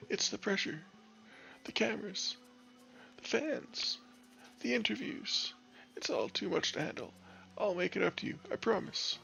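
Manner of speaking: high, desperate pleading to the boss; begging the boss in a high panicked voice. Acted. Frightened player begs boss for forgiveness, high and pleading.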